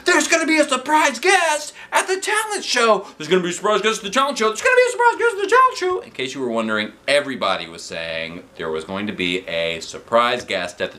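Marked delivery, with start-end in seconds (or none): 0.1-6.0 high loud squealing, faster and faster; excited shout. A narrator's reading. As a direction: shriek the news over and over. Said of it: "Andrea shouted".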